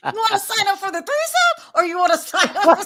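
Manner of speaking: high, loud, laughing throughout; playful cackling question to a chat member; laughing tease. Real, not acted. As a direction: tease him between bursts of laughter. Teasing Larry in chat.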